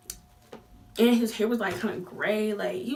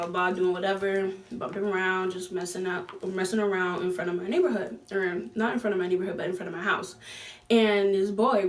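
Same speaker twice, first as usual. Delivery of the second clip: usual speaking voice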